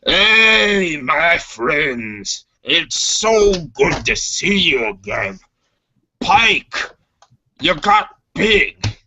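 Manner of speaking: high, far-off alien mechanic voice, hearty; Chopper's greeting. Acted. Mechanic Chopper greets the crew, high and hearty.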